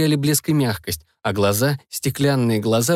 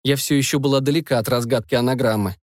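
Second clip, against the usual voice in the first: plain reading voice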